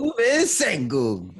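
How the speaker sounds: high, loud, slow come-on; mock dating ad. Guest teasing himself as a smooth single, high and slow. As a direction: sell yourself like a dating ad.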